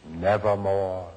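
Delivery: a hint of a low croaking raven; raven's reply. A narrator's reading. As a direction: drop low for the bird's one word. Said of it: "Quoth the raven".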